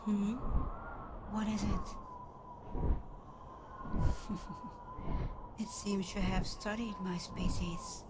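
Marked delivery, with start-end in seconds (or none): 1.9-5.5 amused chuckle. Acted. Dragon amused by the rider.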